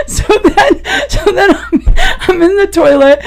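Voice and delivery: high, laughing through the words; laughing while talking. Real, not acted. Teller still laughing while retelling the bathroom scene. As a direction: keep giggling as you go on.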